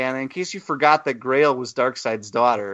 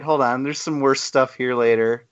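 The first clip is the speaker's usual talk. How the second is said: ordinary talk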